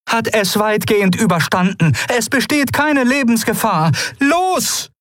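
high voiced companion urging them on; urgent Keru. A narrator's reading. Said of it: "begann Keru".

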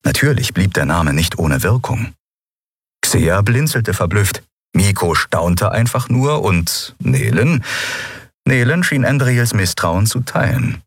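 ordinary delivery, nothing marked

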